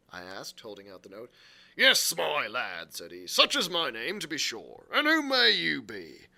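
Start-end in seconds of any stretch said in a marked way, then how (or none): 1.8-6.3 loud, rolling sailor's greeting; hearty landlord. Acted. Silver answers the boy with the note, hearty and loud. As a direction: hail the lad in a big voice.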